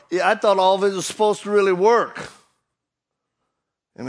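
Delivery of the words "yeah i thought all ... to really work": mocking doubter.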